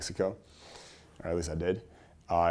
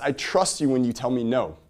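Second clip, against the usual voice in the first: normal talking voice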